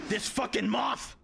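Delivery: slow, drawn-out yell at the moth; real annoyed shout; exasperated shout. Real, not acted. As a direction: bellow it, fed up. Frustrated at a moth.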